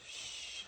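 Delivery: sh, a soft hushing sh; just a light hushed sh; sh sound. Shown as a demonstration. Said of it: "makes like a sh sound".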